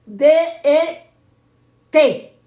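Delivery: loud, separated letters; firm dictation with pauses between letters; spelling out. Shown as a demonstration. Spelling a word for note-takers.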